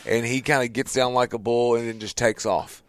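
usual speaking voice

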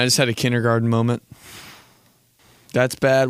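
ordinary talk, nothing marked

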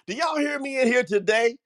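ordinary talk

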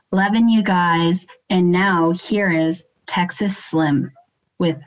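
measured, polished announcer intro read; podcast intro read. Pretend. Guest reading host's scripted podcast intro on cue, measured announcer read. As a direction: welcome listeners in smoothly, phrase by phrase.

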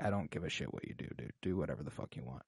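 low, quick, under-the-breath brush-off; mumbled dismissal. Retold or imitated. Detective brushing off the prefect, a quick muttered dismissal. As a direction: toss it away fast and soft. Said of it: "and she's like".

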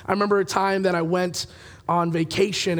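normal talking voice

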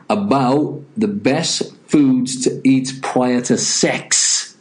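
normal talking voice

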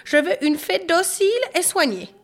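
high-pitched, demanding mother; cross scolding. A narrator's reading. Mother demands an obedient fairy, high cross scolding. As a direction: lay down the law in a high voice. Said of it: "gronda la maman".

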